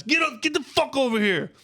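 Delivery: hammed-up angry dad; dad yelling. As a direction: bark it like a fed-up father. Dad yelling in retold story.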